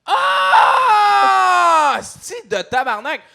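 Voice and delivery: sudden loud mock outburst; outraged yell. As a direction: burst out swearing in fake anger. Fake outrage during prank call.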